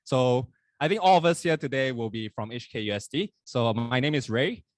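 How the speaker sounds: ordinary talk, nothing marked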